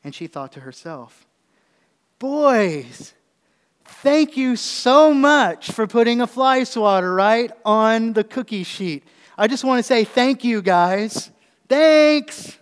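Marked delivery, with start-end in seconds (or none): 2.2-12.6 high, loud, slow mock gratitude; wife sarcastically thanking the boys; sarcastic. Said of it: "That's sarcasm".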